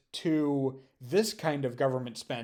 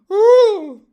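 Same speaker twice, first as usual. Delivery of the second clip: a high, loud mock-celebration whoop; over-the-top woo; 18 dB louder than usual. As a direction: shout it like a cheering fan. Whoop after sarcastic rant.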